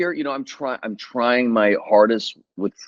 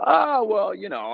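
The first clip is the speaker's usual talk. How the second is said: sheepish backpedaling; 12 semitones higher than usual. Retold or imitated. Actor retelling his hedge to the director about knowing magic, backpedaling. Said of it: "I started backpedaling".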